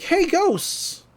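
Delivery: high retold call for attention. Dipper goes out to the ghosts, calling out. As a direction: pitch jumps up in a mock shout.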